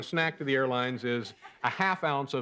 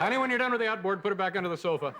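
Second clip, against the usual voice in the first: chirpy wife voice; 7 semitones higher, faster than usual. Acted. Voicing wife at home.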